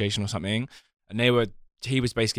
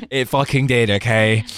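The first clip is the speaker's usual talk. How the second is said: emphatic, much louder; loud insistent; 10 dB louder than usual. Real, not acted. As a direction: insist it, loudly. Insisting clubbing was better.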